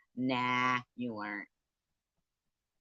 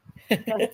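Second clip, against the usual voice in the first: high laugh; 9 semitones higher, 6 dB louder than usual. Real, not acted. Cracking up at own joke.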